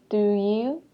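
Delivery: "Do you" rising at the end; "Do you" with a rising question tune. Shown as a demonstration. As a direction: ask "Do you" with an upward lift. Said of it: "in a question you say".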